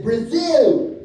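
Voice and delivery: loud, high correction; indignant one-word holler; defiant shout. Retold or imitated. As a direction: yell it to correct him. Woman repeats it louder.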